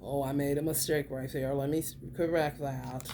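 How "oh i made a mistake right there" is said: usual speaking voice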